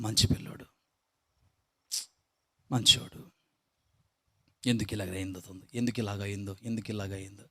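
soft, hushed murmurs of pity; quiet neighbours pitying a sick boy; pitying villagers. Retold or imitated. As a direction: sigh it over him like sorry onlookers. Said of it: "పాపం" అని అనుకుంటూ".

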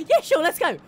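high, loud dopey dog voice; mock the dog agreeing happily; voicing the dog. Gamer voicing the dog following Mike, high and goofy. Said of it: "The dog's like".

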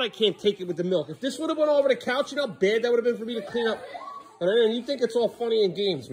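high-pitched fed-up rant; exasperated complaint. Real, not acted. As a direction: complain high, at the end of your patience. Complaining about spilled milk.

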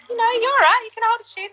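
high, teasing approval; grudging praise, played up; playful mock verdict; imitating Taddy P. Taddy P judging her voice.

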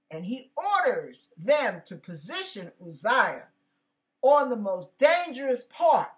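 ordinary talk, nothing marked

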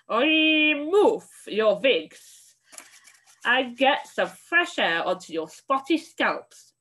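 put-on a witch with a guttural foreign accent yelling; witch shouting. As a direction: roll each word out as she yells. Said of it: "she shouted".

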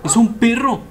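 sudden startled exclamation; surprised. Real, not acted. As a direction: caught off guard by the dog. Sudden dog in cutscene.

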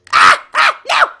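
short, very loud yelps; panicked screams. Real, not acted. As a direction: shout in bursts as things go wrong. Getting killed in the game.

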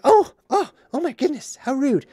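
high-pitched, scandalized mock gasping; mock-offended lady. Lady reacting to the perfume insult, mock offended.

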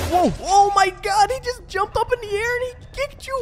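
high, loud amazed outburst; stunned high-pitched exclaim; shocked. Real, not acted. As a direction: gasp it out, stunned. Shocked by Spider-Man's kick.

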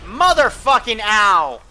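pained yell. Real, not acted. Player hit in firefight.

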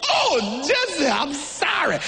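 slow, drawn-out mock apology; the wife backing down, sorry; sheepish wife apology. Wife apologizes slowly once she realizes her mistake. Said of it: "Oh, she went".

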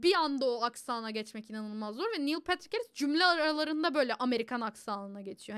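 ordinary talk, nothing marked

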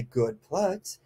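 soft, gentle praise; calm praise. Shown as a demonstration. Trainer praising a puppy put into down. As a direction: praise the dog calmly and nicely. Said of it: "talking to them calmly, gently, nicely".

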